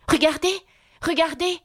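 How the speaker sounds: put-on high, urgent girl's cry; excited exclamation. A narrator's reading. Alice points out something to the king, excited. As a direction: call attention eagerly, pitched right up. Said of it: "s'écria-t-elle en tendant vivement le doigt".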